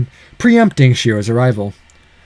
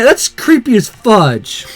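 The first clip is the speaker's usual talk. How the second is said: slow, emphatic disgust; 5 semitones higher, slower than usual. Real, not acted. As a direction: drag it out in disgust.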